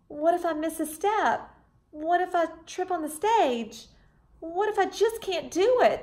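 put-on anxious young dancer voice; nervous worries. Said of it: "She became frightened".

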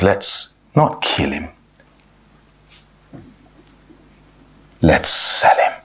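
low, slow, calculated delivery; Judah. Acted. Judah proposes selling his brother, low and coolly deliberate. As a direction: drop low and draw it out, voice fading away. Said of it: "that's the sharp contrast".

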